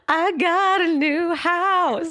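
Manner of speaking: high, slow, loud reveal; excited announcement. Real, not acted. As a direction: draw it out, bursting with news. Announcing big news excitedly.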